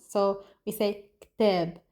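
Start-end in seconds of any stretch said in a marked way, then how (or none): 1.2-1.8 "كتاب" as "kteb"; Lebanese pronunciation. Shown as a demonstration. Teacher explaining the vowel change, Lebanese pronunciation. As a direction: turn the a of "كتاب" into eh, no first vowel. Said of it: "the a sound becomes".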